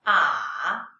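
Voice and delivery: "ah" dipping low, then rising; third tone. Shown as a demonstration. Teacher leading read-after-me tone drill, dipping "ah". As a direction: sink "ah" low and bring it back up. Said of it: "the tones".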